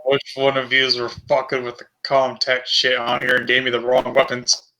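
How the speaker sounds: ordinary talk, nothing marked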